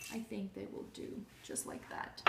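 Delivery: breathy whisper to herself while working; half-whisper, mostly to herself; whispered aside. Real, not acted.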